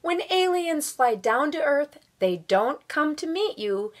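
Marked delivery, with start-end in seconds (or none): none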